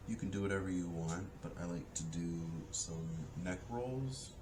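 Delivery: soft, slow guided breathing voice; soft spa whisper. Acted. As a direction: guide them gently through each breath. Leads a breathing exercise.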